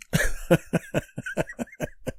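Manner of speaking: airy unvoiced chuckle; silent laugh. Real, not acted. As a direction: laugh under your breath. Breathy laugh.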